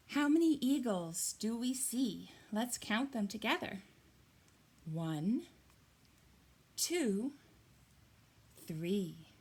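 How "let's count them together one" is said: ordinary talk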